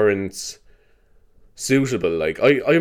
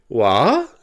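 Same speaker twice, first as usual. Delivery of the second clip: "What?" as a rising question; questioning squeak imitation. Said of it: "ones that sound like a question".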